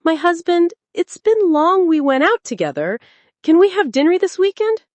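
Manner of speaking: high, hopeful voice of a pleading wife. A narrator's reading. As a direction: lift it up, gently hopeful. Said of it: "approached him with a hopeful smile".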